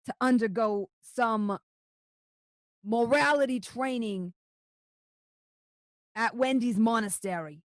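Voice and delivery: slow, measured official decree; stern official voice. Acted. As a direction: lay down the terms slowly.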